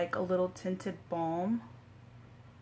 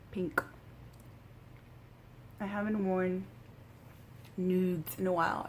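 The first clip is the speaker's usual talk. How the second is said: ordinary talk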